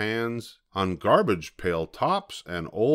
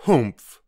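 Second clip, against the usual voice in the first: loud, sudden humph; a huffy humph, punched out; disdainful humph; 3 semitones higher, 7 dB louder than usual. A narrator's reading. Kangaroo scoffing at Horton, disdainful humph. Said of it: "humphed a voice".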